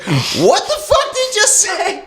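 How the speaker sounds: high slow incredulous voice; stunned worker. Retold or imitated. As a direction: play the stunned drive-thru worker. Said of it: "They're all fucking like".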